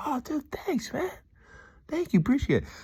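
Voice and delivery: very high imitated gratitude; delighted thanks. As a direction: gush thanks in a squeaky-high voice. Acts out delighted man's reply.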